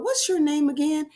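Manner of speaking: high playful question; magic E asking. Acted. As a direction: ask it like a curious little letter. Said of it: "hop over and ask the vowel".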